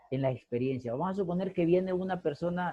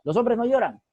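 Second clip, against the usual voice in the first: a hint of a stern father, higher and a bit louder; 7 semitones higher, 5 dB louder than usual. Teacher quoting her father to the class, lightly stern. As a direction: echo dad's old rule with mild sternness. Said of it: "Así me decía mi papá".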